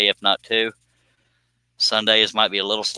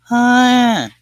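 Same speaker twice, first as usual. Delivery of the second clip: high yelp; 5 dB louder than usual. Real, not acted. Sudden yelp and cough.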